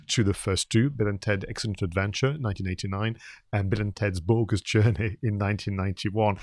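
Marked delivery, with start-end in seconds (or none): none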